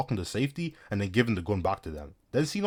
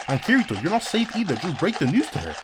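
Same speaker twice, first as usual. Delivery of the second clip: raised pitch, telling him off; mock scolding; 9 semitones higher than usual. Narrator tells Kirito off, high mock scolding.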